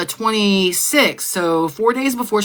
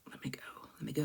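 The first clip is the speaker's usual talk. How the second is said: whisper; 4 semitones lower, 24 dB quieter, much faster than usual. Real, not acted. Whispering to herself before recording.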